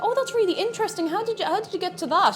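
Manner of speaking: high, eager feigned interest; mock fascinated. Streamer retelling stalling a preacher, high mock interest. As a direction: play along sweetly to waste their time. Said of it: "I was just like".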